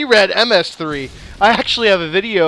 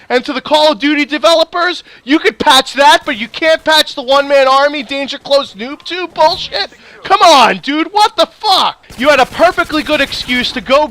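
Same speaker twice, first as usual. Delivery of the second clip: raised, heated, exasperated ranting; angry rant; 5 semitones higher, 3 dB louder than usual. Real, not acted. Ranting at Call of Duty developers, heated and raised. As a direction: let the frustration boil over at the developers.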